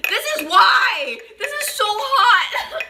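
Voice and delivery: high laughing yell, this is why; laugh-yell through the burn; pained yelp. Real, not acted. Burning hot drink, yelling.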